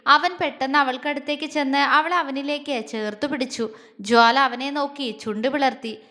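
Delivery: ordinary delivery, nothing marked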